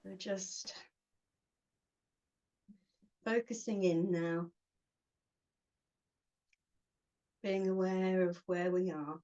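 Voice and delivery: short phrases with long pauses between; unhurried meditation guide, giving each phrase room to settle; guided meditation. Acted. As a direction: guide them gently inward, phrase by phrase.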